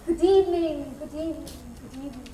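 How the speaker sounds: performed greeting, trailing into near silence; acted greeting. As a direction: let each repeated greeting die away. Reporter's greeting acted in monologue.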